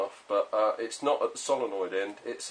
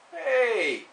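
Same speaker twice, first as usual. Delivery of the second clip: sudden high "Hey!"; surprised; 12 semitones higher, 6 dB louder than usual. Real, not acted. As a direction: blurt it out, startled. Sudden exclamation to camera.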